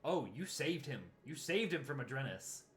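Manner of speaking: usual speaking voice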